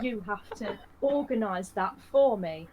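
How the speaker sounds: hammed-up demand dissolving in laughs; laughing through it. Mark Darrow demanding the narrator sort out his prize, laughing. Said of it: "And he's like".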